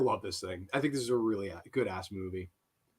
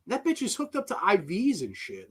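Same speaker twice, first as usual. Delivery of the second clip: ordinary talk, nothing marked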